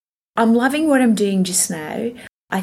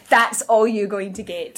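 laughing; 3 semitones higher than usual. Real, not acted. Laughing after the story.

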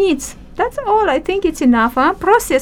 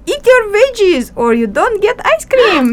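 lightly voiced parent, pitched higher; higher-pitched mock scolding; mock parental warning; 7 semitones higher than usual. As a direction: playfully lay down the rule to a child. Acted parent warning a child.

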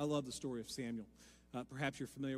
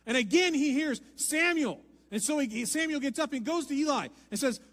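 much louder and higher, pressing on; full-force preaching; 9 semitones higher, 9 dB louder than usual. Acted. Preacher acting out Eli and Samuel at full force. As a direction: preach it out loud to the room.

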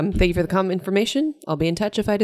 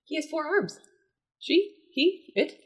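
surprised; 11 semitones higher, 4 dB quieter, slower than usual. Real, not acted. Reacting to creature picture.

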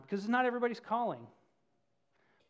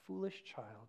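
soft, low motherly chiding; a tender mother soothing her son; gentle; 6 semitones lower, 11 dB quieter, slower than usual. A narrator's reading. Mother donkey gently scolding her weeping son, softly. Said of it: "she said gently".

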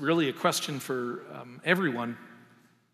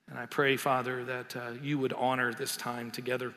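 pastoral prayer; 2 semitones lower, 6 dB quieter than usual. Acted. Pastor praying over the congregation before the sermon, soft and reverent. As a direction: pray quietly, leaving long pauses.

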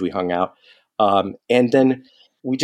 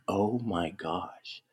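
slow, breathless, barely voiced oh my gosh; awestruck hush; 5 semitones lower, 14 dB quieter, much slower than usual. Fan invited to coffee by his guitar hero, awestruck hush. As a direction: let the awe steal your breath.

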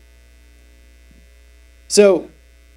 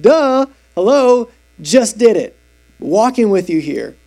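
mock exasperated Jesus; 4 semitones higher, 4 dB louder, slower than usual. Jesus voiced mocking Pharisees.

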